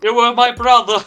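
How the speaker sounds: loud, high cry of betrayal; mock-dramatic outburst. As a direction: cry it out like a wronged brother, hammed up. Mock tragic outburst to Jacob.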